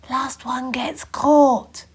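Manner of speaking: slow whispered warning. A narrator's reading. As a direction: whisper the dare. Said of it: "whispered Hector".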